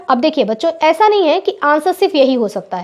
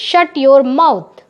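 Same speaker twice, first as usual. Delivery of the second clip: slow dictation; much slower than usual. Shown as a demonstration. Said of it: "दोनों एक ही बात है ना".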